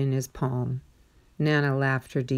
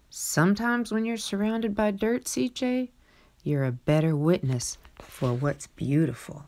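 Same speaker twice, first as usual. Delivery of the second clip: tender grandmother; 5 semitones higher than usual. Acted. Nana answers CJ gently.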